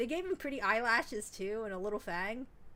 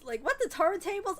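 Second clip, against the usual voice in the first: mock flustered surprise; 7 semitones higher, 5 dB louder than usual. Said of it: "I was just like".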